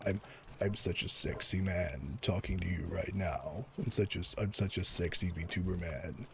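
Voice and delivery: low, soft, close-mic purr with vocal fry; mock sexy male VTuber. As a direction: parody a male VTuber seducing his viewers. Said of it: "you add in a little bit of vocal fry here".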